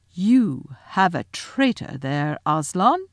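slow, deliberate witch's accusation; imperious witch, very measured; accusing witch. Acted. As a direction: draw out the charge coldly. Witch accuses before Aslan.

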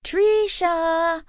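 high, loud calling a name. A narrator's reading. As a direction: call her from across the fair. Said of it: "I heard my Bubby call out".

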